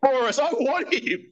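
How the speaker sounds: giggling, high-voiced mock innocence; laughing high. Real, not acted. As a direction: laugh through a mock defence.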